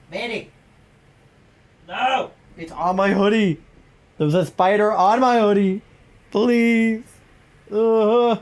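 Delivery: high panicked squeals; screaming at a spider. Real, not acted. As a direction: shriek at the spider crawling on you. Spider on her hoodie.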